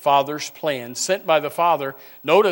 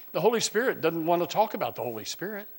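ordinary delivery

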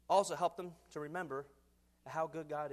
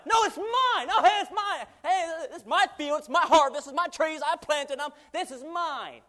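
high, loud insistent claim of ownership; God shouting mine; 8 semitones higher, 10 dB louder, slower than usual. Retold or imitated. God shouting at a greedy teen gatherer that the harvest is his. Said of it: "and God said".

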